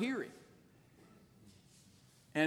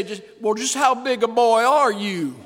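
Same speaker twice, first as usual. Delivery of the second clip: louder, higher mock-tough taunt; goading a man into a fight; Roy D. Mercer challenge; 5 semitones higher, 11 dB louder than usual. Radio prankster goading a caller, mock tough. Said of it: "he would say".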